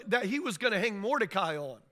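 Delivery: ordinary talk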